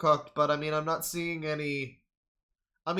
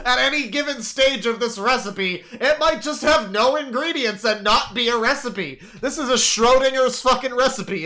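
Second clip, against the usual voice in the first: building rant; 6 semitones higher, 7 dB louder than usual. Real, not acted. Rising incredulous rant.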